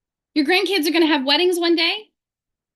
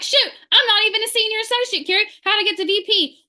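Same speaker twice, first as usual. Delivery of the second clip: higher, lightly voiced panicked recruit; mock panic; 7 semitones higher than usual. Imitating a new associate's alarm at the VP goal, mock panic. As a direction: play a flustered newbie in disbelief. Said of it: "You're like".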